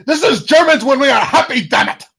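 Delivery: put-on loud, high German-accented shouting; hammed-up furious German insisting on happiness; angry German voice. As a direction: yell it as a comic angry German. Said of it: "Do your best angry German voice".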